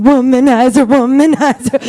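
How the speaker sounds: mock frantic yelling; running and screaming. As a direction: belt the word out repeatedly. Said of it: "running and screaming".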